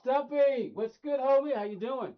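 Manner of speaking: high, loud shout of greeting; hearty hailing. Real, not acted. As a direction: hail a friend at full volume. Hailing a viewer joining the chat.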